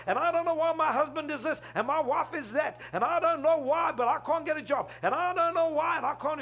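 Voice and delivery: high, loud run-on whine; mock complaining. Church members griping to God about their troubles, complaining.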